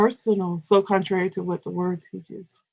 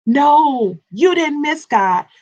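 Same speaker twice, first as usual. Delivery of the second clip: usual speaking voice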